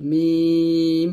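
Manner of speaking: "মিম" thin, with a long vowel; thin pronunciation. Shown as a demonstration. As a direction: draw out a light "মিম". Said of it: "পাতলা হবে".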